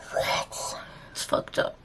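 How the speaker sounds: a hushed, crying whisper; tearful whisper. Real, not acted. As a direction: whisper through the tears at one in the morning.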